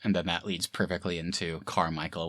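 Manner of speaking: ordinary talk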